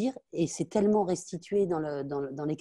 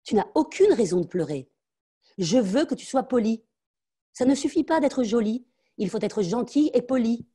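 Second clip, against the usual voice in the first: higher and louder, hard and lecturing; harsh scolding; 7 semitones higher, 5 dB louder than usual. Acted. Mother scolding her crying daughter, higher and louder.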